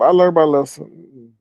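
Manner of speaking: normal talking voice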